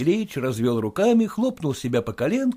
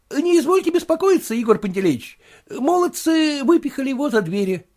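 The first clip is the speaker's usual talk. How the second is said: much higher, fussy clerk's voice; clerk reporting; 12 semitones higher than usual. Acted. The clerk tells his master about the drunk neighbour, fussy.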